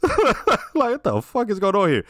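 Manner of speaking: high, laughing mock bewilderment; laughing quote. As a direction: crack up while imitating a baffled onlooker. Laughing while voicing Junior's look.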